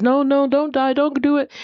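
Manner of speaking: high, frantic begging; a frog brother cries out high and frantic, begging his brother not to die; desperate pleading. Acted. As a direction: beg your brother not to give up, voice shooting up high.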